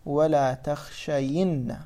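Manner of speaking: "ولا تخشين" drawn out slowly, syllable by syllable; slow demonstration. Shown as a demonstration. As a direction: stretch out "ولا تخشين" for students. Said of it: "نأتي نحرك الياء نقول".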